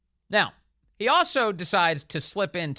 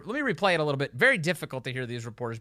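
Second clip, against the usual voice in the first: usual speaking voice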